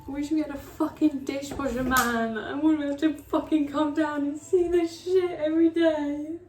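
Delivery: angry laughing rant. Real, not acted. Vlogger wishing for a dishwasher, loud laugh-crying rant. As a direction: rant and half laugh, half cry.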